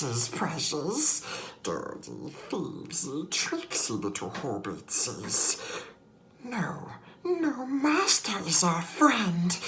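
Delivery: slow, high creature voice dropping to whispers; creepy split creature voice; Gollum. Retold or imitated. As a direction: Gollum arguing with himself. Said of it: "I got pretty good at Gollum".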